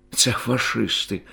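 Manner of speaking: usual speaking voice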